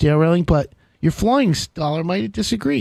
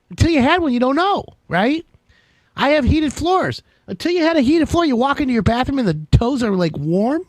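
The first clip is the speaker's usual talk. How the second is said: normal talking voice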